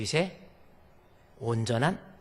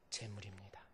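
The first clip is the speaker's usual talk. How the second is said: much softer, breathy whisper; 9 semitones lower, 18 dB quieter than usual. Acted. Preacher whispering the offering to the congregation. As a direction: let it fall to a whisper.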